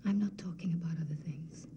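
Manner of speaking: quiet whispered denial. Acted. Antigone answering Ismene in a whisper. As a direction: breathe the denial. Deflecting sister's question.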